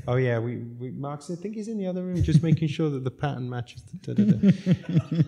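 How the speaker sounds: giggling fake excuse; laughing. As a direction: laugh through a flimsy cover story. Friends covering for hungover him.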